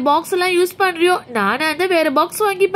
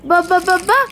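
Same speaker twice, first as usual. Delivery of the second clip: ordinary delivery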